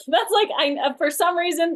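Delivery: amused, laughing aside. Real, not acted. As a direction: laugh while explaining yourself. Laughs at her own catchphrase.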